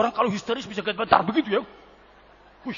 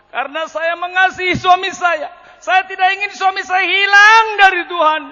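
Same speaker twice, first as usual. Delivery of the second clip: loud, high, pleading outcry; imitated hysterical wife; 10 semitones higher, 8 dB louder than usual. Wife explaining why she left her children, hysterical. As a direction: cry it out high in mock distress. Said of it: "Ini kok histeris terus".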